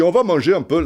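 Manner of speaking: put-on high, botched Belgian accent sounding African; a failed accent imitation, slipping into African; Goethals, accidental African accent. Coach inviting him to eat, Belgian accent botched into African. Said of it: "je te faisais la, l'accent, euh, africain".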